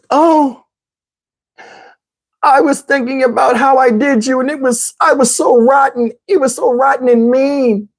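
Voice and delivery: high, slow, whimpering confession; imitating tearful woman. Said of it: "I'm gonna do her voice exactly how she said".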